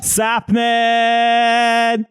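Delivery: high, long sustained holler; shouted catchphrase. Pretend. Co-host answering the opening call, long high holler. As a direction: hold the last syllable in a high shout.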